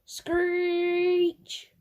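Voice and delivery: high screeching car brakes. A narrator's reading. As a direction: screech like tyres skidding. Car crash sound effect.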